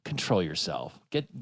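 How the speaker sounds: ordinary talk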